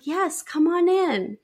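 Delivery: high, drawn-out friendly invitation; slow, lilting welcome; welcoming. Retold or imitated. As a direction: usher a nervous guest in, voice lifted. Acted welcome at studio door.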